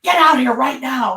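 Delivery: urgent call. Retold or imitated. Husband calling his wife out to hear the howl, urgent loud call. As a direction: yell it like someone who just heard the howl outside. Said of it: "he's like".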